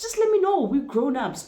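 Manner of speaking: pleading in exasperation; exasperated plea. Retold or imitated. Telling her date to be straight, exasperated plea.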